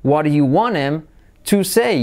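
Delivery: "want him" merged into "wanem"; linked "What do you wan-em... to say"; dropped H. Shown as a demonstration. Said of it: "nos comemos la H también".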